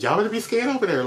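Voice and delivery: sassy drag queen. Acted. Sassy warning to rival fans.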